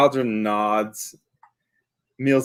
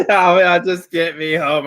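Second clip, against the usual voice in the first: laughing plea; 7 semitones higher, 7 dB louder than usual. Acted. Player pleads to bear mockingly.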